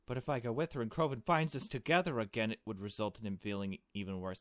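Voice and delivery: usual speaking voice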